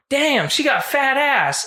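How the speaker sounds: put-on high, slow teen shout-out; teen heckler. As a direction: drawl it like a rowdy teen in the theater. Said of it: "the teenagers in the theater go".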